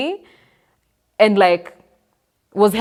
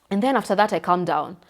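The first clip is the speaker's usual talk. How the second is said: usual speaking voice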